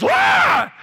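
sudden high yelp of fright; startled scream. Retold or imitated. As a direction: yelp loud and high, caught off guard. Dad startled by tarantula.

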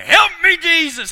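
loud, high cry for help; panicked cry. Retold or imitated. Sinking Peter crying out to Jesus in mock panic. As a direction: yell it like you're going under. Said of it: "And he's like".

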